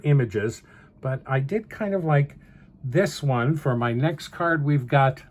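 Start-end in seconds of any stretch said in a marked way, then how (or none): none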